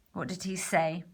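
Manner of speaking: ordinary delivery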